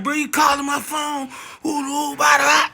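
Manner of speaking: mock annoyed. Owner annoyed at repeated calls, mock irritated whine. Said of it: "he started getting mad at me".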